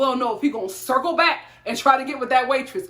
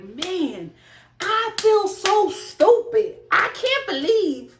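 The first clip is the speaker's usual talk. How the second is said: mock Jessica voice, higher and slow; imitating Jessica; 7 semitones higher, much slower than usual. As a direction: drag out the words like she did. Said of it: "When did you start talking like that?".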